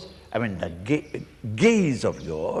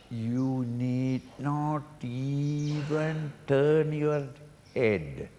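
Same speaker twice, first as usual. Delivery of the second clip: usual speaking voice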